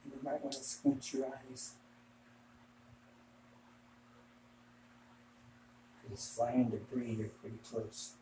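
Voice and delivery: muffled under a respirator mask; through a respirator mask. Real, not acted. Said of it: "Gotta get a respirator on".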